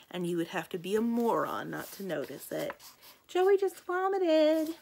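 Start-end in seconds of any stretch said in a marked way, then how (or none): 3.3-4.8 high, loud, drawn-out call; gleeful announcement. Acted. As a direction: sing out juicy news, stretching every word. Acted example of gossip called out.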